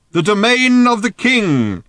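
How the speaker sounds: high, slow, ringing holler; shouting back. A narrator's reading. Old man answers the riders' question, shouting back. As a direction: proclaim it loudly across a distance. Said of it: "shouted old Thrashbarg back".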